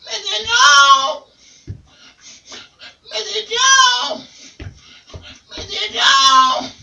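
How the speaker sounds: very high, slow shrieking parrot; high wailing parrot calls; parrot squawk. Acted. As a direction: screech it like a crazed bird. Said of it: "you hear the bird".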